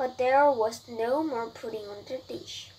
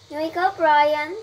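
raised, slow wake-up call; calling to wake someone; 5 semitones higher, 8 dB louder, slower than usual. Acted. A voice wakes Brian, calling out. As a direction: call out louder and higher, drawn out.

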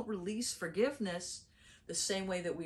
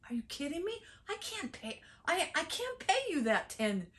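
acted high-pitched protest from a debtor; panicked servant; 9 semitones higher than usual. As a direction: play a flustered servant who can't pay. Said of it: "And the servant said".

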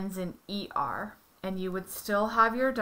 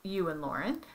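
normal talking voice

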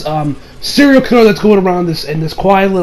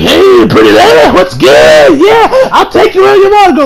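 much higher, louder hammed-up shout; catcalling sleazeball; 11 semitones higher, 5 dB louder than usual. Sleazy dude catcalling a woman, loud high shout. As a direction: holler at her like a cheesy street creep. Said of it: "This woman be like".

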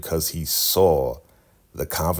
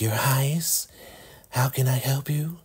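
high, softly put-on woman's voice; mock eager-to-serve falsetto; Joan; 7 semitones higher, 4 dB quieter than usual. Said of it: "You know how she talks".

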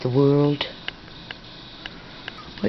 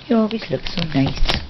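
usual speaking voice

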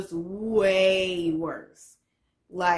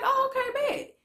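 mock high okay. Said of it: "I was like".

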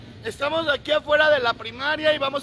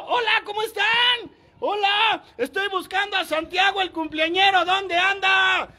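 calling out; 7 semitones higher than usual. Real, not acted. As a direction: call across the classroom. Searching classrooms, calling out. (saludando a gritos a los niños)

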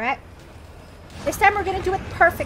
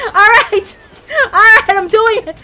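laughing relief; 3 semitones higher, 7 dB louder, slower than usual. Real, not acted.

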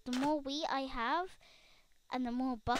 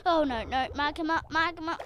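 alarmed; 4 semitones higher, 10 dB louder than usual. Real, not acted. Falling into magma in game.

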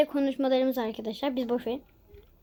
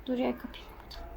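whispering while hiding; 4 semitones lower, 12 dB quieter than usual. Real, not acted. Hiding from Granny.

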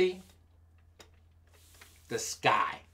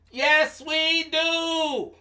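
higher, lightly voiced animal answer; sing-out yes from storybook animals; eager animals; 9 semitones higher, 4 dB louder, much slower than usual. Animals accepting lion's help, lightly eager.

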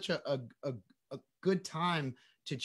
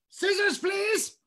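high, drawn-out mock shout; mock demand; 7 dB louder, much slower than usual. Barber calling for scissors before a cut, hammed-up high demand. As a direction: call it out slowly, pitched way up.

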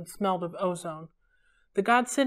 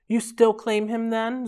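ordinary delivery

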